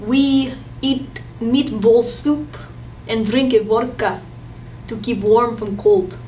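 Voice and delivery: slow, heavy mock Russian accent; hammed-up Russian; exaggerated Russian English. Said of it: "But in Russia".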